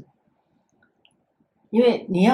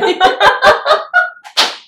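a high, loud burst of laughter; grandma laughing at her grandson's apology; 10 dB louder than usual. Real, not acted. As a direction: let out a delighted squealing laugh. Laughing after the story.

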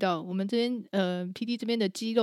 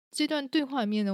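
ordinary talk

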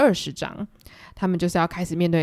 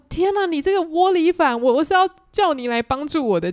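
much higher, louder outraged wife; exasperated; 9 semitones higher, 4 dB louder than usual. Retold or imitated. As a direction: scold the husband who sided against her. Mother scolds husband for betrayal. (气急败坏地骂丈夫，声音更高更响)

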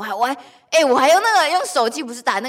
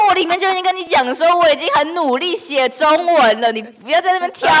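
higher, louder mock protest; 5 semitones higher, 6 dB louder than usual. Telling the sister not to nitpick her Chinese, mock protest. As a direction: playfully complain about nitpicking. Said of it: "我就说".

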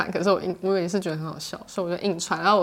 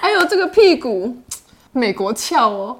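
high, loud then slow mock admiration; sarcastic teasing; 3 semitones higher, 5 dB louder, slower than usual. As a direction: tease a relative's behind with fake awe. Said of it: "我用反讽法". (夸张反讽的调侃)

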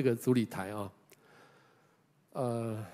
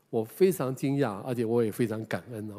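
normal talking voice

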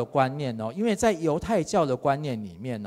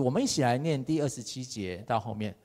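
usual speaking voice

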